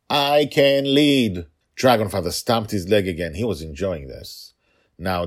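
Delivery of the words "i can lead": put-on high, loud, slow drawn-out kid voice; mock whiny child. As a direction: stretch it out, mocking a stubborn girl. Father mocking daughter again.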